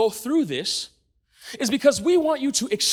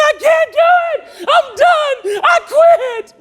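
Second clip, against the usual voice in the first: much higher, louder wailing shriek; screaming meltdown, laughing through it; toddler-like scream; 9 dB louder, much slower than usual. Retold or imitated. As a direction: shriek it like a toddler denied her show. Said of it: "screaming at the top of my lungs".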